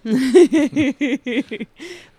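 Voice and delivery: loud high laughing burst; loud burst of laughter. Real, not acted. Big laugh.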